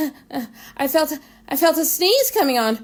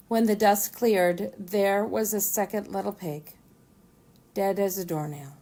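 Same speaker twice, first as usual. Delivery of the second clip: ordinary delivery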